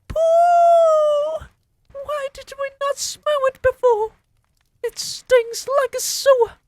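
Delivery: very high, loud witch screech; shouting. Acted. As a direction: screech in disgust. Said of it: "they shouted".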